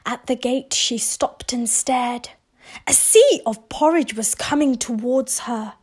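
usual speaking voice

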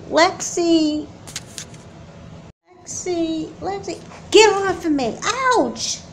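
scared shriek under attack; panicked cry. Acted. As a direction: yelp as she is grabbed. Attacked by her sister.

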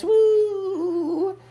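high, gleeful whoop; excited whoop. Acted. The bugs fly away on bog wings, whooping. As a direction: cheer as you take off.

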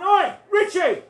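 loud high slow "Oi, Richie!"; shouting across the pitch. Retold or imitated. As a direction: yell to the striker across the field. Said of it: "So I'm going".

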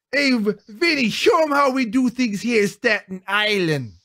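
Italian mafia impression. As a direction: play a cartoon Staten Island mobster sizing up a punk. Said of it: "Italian, speaking of mafia".

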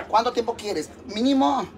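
usual speaking voice

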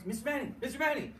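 imitating an eager kid calling, pitched high; high-pitched mimicked call across the room; retold calling out. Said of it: "he's yelling at me from across".

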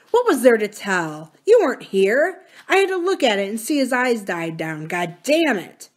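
loud, violent anguished swearing; violent outburst. A narrator's reading. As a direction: lash out in pain and shame. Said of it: "violent and cringing".

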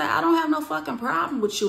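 high, loud insistence; worked-up retold retort; retold confrontation.

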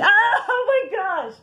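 squealing with giddy laughter; half laughing, half crying with joy; excited laugh-cry. Real, not acted. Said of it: "my voice might get quivery 'cause I'm all excited now".

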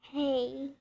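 usual speaking voice

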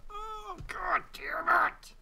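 high, strained, slow curse; real exasperated groan-shout; frustrated outburst. Real, not acted. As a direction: let the failure burst out. Loses the run again.

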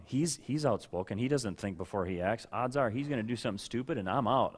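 ordinary talk, nothing marked

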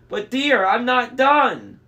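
high, loud, drawn-out pleading; wailing fake bride voice; falsetto. A narrator's reading. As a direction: whine to the monster in falsetto. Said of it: "in his falsetto voice".

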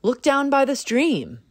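a proud bird pointing the way; cardinal voice. A narrator's reading. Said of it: "cried Cardinal".

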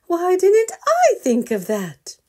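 high, loud glad exclamation; delighted mother bear. Acted. Mother bear praising Grandpa's picnic plan, high and delighted. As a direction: gush over the idea.

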